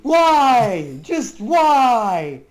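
loud, pleading why; exasperated. Real, not acted. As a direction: complain loudly in disbelief. Exasperated complaint to teammate.

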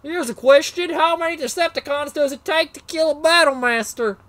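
put-on Southern accent, telling a joke punchline. Joke question to stream viewers in a Southern accent. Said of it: "I did that with a southern accent".

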